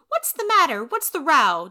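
put-on a girl's loud demand; child demanding. Anne demanding to know the fuss, high and loud. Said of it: "demanded Anne".

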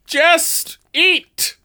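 high, proud stage knight; hammy stage actor. Acted. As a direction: offer it like a gallant hero. Knight announces himself.

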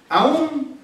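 AÚN drawn out, high and loud; emphatic stress. Shown as a demonstration. Preacher underlining the passage's word, emphatic stress on "Aún". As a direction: punch "Aún" high and strong. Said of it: "es más enfático".

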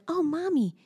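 put-on high little-girl voice; imitating her daughter's delighted voice; toddler voice. Mother imitating her little daughter's surprise. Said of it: "she went".

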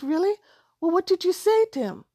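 high, bright mock surprise; surprised mom. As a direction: play up the surprise for the child. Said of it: "And I said".

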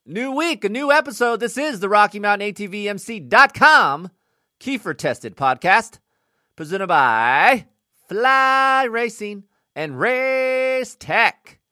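wired, loud, high-energy host; bouncing off the walls on coffee; too much caffeine. Real, not acted. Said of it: "I am jacked up, three cups of coffee deep".

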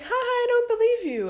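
high, loud mocking disbelief; mock-laughing retort. Acted retort to Tinder message.

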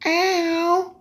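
squeaky high hey ow; high screechy phone voice. Retold or imitated. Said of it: "That's what you say".

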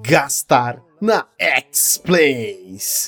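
slow ad punchline. Acted. Ad read slogan.

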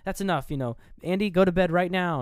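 mild mock stern mother; stern mom. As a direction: snap at the kid to go to bed. Mom ordering child to bed.

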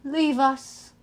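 measured, haughty command; cool, commanding send-off; imperious order. A narrator's reading. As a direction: dismiss them like underlings. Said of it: "Tantalus ordered".

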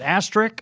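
asterisk in two syllables, ending in K; asterisk as astrick. Shown as a demonstration. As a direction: say asterisk as "AS-trick". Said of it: "I'm asking. Is it asterisk or".

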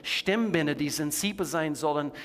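rising, impassioned preacher's plea; fervent preaching. Acted. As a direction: preach it with full conviction.

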